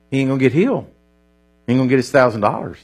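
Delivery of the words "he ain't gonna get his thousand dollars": ordinary talk, nothing marked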